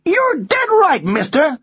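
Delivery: high American parrot voice; American accent. Acted. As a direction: retort as a high-voiced American wise guy. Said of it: "you have an American accent".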